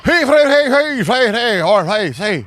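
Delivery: high, slurred urgent hollering; drunk captain babble. Pretend. Drunk Irish sub captain warning the crew, slurred hollering.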